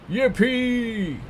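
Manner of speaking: very high, loud whoop; squealed cheer; joyful cry. A narrator's reading. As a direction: whoop it with a long held ending. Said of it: "cried out".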